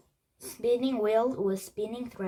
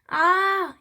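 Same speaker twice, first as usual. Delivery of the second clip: high, loud scream of "ah"; yelled "ah"; 10 semitones higher, 9 dB louder than usual. A narrator's reading. Jack joins Teddy screaming at the bones, loud high yell. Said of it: "yelled Jack".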